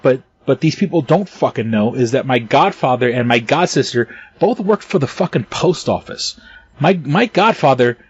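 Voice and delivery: usual speaking voice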